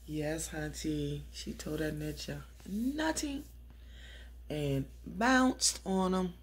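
slow, drawn-out gossip glee; slow sassy gossip relish; sassy gloat. Real, not acted. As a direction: savor it like juicy tea. Gleeful gossip aside.

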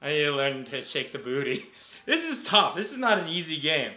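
ordinary talk, nothing marked

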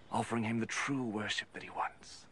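ordinary delivery

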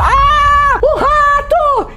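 very high, loud, drawn-out squeal; hammed-up shriek. Minnie shrieking at a mouse, hammed-up and high. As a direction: overdo a cartoon fright. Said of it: "Ela tá".

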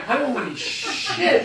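high, drawn-out exclamation of mock shock; retold shock. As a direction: stretch it out slowly, stunned by the sight. Retold shock at the snarling dog.

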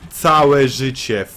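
slow, stretched-out repeat; second take slow and drawn out; slow drawn-out lament. Real, not acted. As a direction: drag each syllable out in despair.

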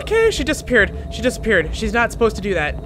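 high, loud, agitated; rattled alarm. Real, not acted. Player alarmed that ghost disappeared, high rattled voice. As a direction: blurt it out loudly, still spooked.

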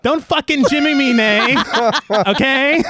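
high-pitched, laughing telling-off; mock scolding. Host playfully tells off co-host over a favorite director, mock scolding. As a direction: playfully protest through laughter, pitch jumping up.

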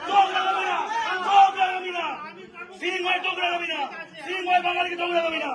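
high-pitched, insistent repeated shouts; angry protest shouting. Real, not acted. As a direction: hurl each warning like a protest slogan. Angry protest against being insulted.

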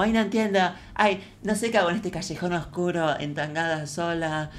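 aguda e inocentona, apagándose al final; imitando a Rosita. Retold or imitated. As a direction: hace de ingenua perdida. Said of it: "parece una película porno". (imitación aniñada de Rosita)

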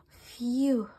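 ordinary delivery

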